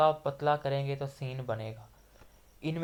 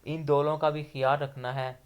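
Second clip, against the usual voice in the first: ordinary delivery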